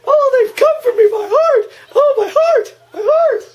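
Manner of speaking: put-on very high, loud, laughing old woman cry; hammed-up old lady panic. Voicing Missus Deagle in fright, very high mock wail. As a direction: clutch your heart and wail it.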